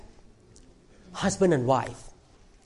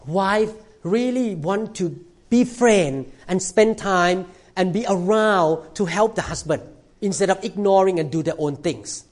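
usual speaking voice

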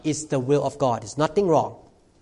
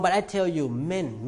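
ordinary talk

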